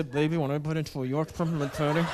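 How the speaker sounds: husky seductive through clenched teeth; low sexy talk through teeth. Shown as a demonstration. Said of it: "talk through clenched teeth".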